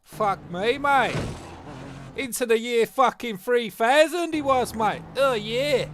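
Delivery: loud, high-pitched amazed outburst; hammed-up excited commentary; mock astonishment. Replays his astonishment at the flyby.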